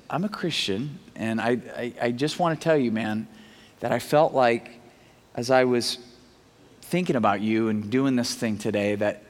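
low, softened, halting delivery; careful, hesitant confession. Retold or imitated. Test driver broaching marriage to salesman, hesitant. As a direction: ease into it gently with pauses.

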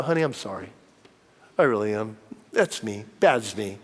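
low, soft apology to a wife; apologetic husband. Retold or imitated. Husband apologizing to his wife in the car, low and contrite. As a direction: say sorry quietly, voice dropped and humble.

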